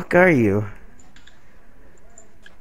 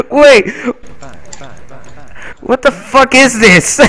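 shocked; 8 semitones higher than usual. Real, not acted. Friend reacts to a glitchy game noise, shocked high yell. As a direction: caught off guard by a weird sound.

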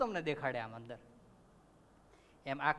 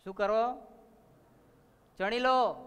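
raised, louder command; king's order; 5 semitones higher, 6 dB louder, slower than usual. Acted. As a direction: give the order sharply as an enraged king. King orders the queen's execution. (રાજાનો ઊંચા અવાજે હુકમ)

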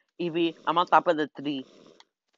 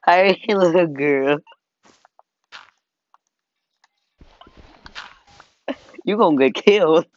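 mock menace; 3 semitones higher, 5 dB louder than usual. Teasing threat to player.

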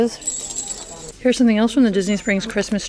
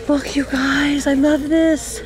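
higher, bright-eyed delight; excited; 6 semitones higher, slower than usual. Real, not acted. Vlogger showing viewers her find, excited and higher. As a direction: gush over the find.

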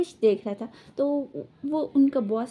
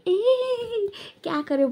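a sudden high yelp of alarm; startled cry; 7 semitones higher, slower than usual. Acted. Boss yelps as Wing twists his arm, alarmed. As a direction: jump up in pitch, caught off guard.

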